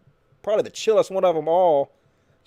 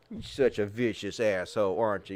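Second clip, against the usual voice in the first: normal talking voice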